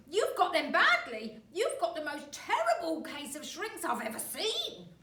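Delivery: grin horribly through it; horrible grin. Acted. Husband taunts wife.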